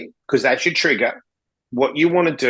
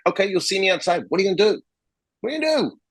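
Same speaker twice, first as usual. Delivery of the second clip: high-pitched mock challenge, climbing on the repeat; retold taunting challenge; 6 semitones higher, much faster than usual. Answering the shopper's threat to meet outside, retold taunting challenge. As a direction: throw the dare back, voice rising sharply.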